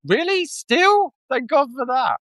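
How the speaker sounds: very high, incredulous questions; mock surprise. Passenger joking with cab driver about London, mock surprise. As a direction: act out joking astonishment and relief.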